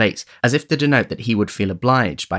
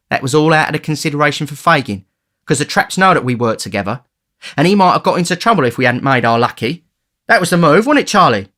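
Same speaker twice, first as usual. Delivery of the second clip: a hint of a boastful street boy, rising higher; lightly voiced cocky young thief; 7 semitones higher, 6 dB louder than usual. A narrator's reading. The Dodger boasting to Oliver, lightly voiced and cocky. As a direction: brag down the list of names, lightly.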